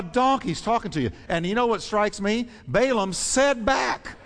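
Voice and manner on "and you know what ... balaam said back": ordinary talk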